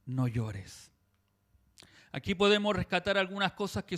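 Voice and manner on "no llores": tender. Retold or imitated. Jesus looking at the widow, tender and low. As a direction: say it low and kind. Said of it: "la observa y le dice".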